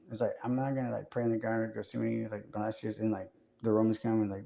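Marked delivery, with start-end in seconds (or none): none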